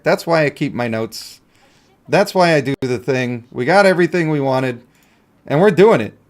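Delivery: ordinary delivery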